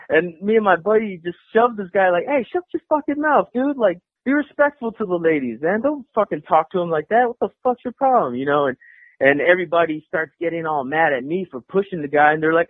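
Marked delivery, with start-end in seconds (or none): 2.3-8.4 retold angry confrontation. Retold confrontation after shoving him.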